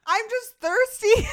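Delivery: laughing. Real, not acted. Laughing after spitting out water.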